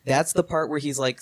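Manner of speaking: normal talking voice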